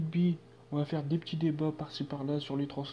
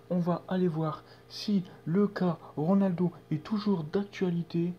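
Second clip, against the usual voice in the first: ordinary delivery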